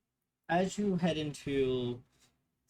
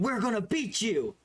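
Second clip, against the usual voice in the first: put-on excited kid; 8 semitones higher, 4 dB louder than usual. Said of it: "the other kids going".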